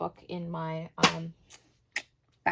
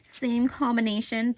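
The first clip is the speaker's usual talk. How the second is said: ordinary talk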